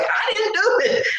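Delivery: very high squeaky denial; mock innocent protest. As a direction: protest your innocence in comic falsetto. Mock innocence to teasing witnesses.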